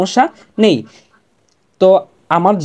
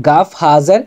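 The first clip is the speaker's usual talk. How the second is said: usual speaking voice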